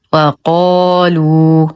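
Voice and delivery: وقالوا recited very slowly, thin و and full ق; heavy qaf, light waw. Shown as a demonstration. Teacher modelling correct recitation, slow وقالوا with heavy qaf. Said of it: "والصواب".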